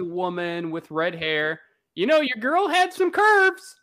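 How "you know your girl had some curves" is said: playful teasing. Real, not acted.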